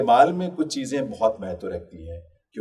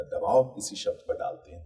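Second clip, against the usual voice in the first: ordinary delivery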